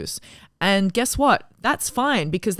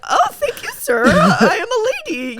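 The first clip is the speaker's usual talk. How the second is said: much higher, slow, dainty mock lady voice; prim lady; 7 dB louder, slower than usual. Thanking a man holding the door, a high dainty lady voice. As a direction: play the delicate lady, laughing through it. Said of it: "I'm like".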